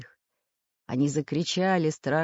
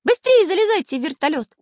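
high, loud, anxious urging; agitated; 8 dB louder than usual. A narrator's reading. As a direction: urge them aboard in a worried shout. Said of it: "волновался Карандаш".

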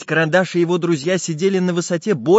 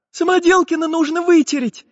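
put-on high little-girl voice; Nastenka's voice; 5 dB louder than usual. A narrator's reading. Little girl wants her wet friend dried, high and urgent. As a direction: pipe up high and loud. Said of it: "сказала Настенька".

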